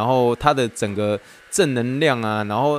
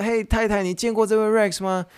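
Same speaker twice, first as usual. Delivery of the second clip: excited husband; 10 semitones higher than usual. Retold or imitated. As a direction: call your wife over in disbelief. Translating the husband in same voice. (丈夫惊奇地大声叫太太)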